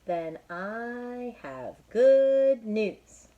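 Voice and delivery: high, loud, very slow happy news; cheerful Piggy. Acted. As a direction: sing out the good news.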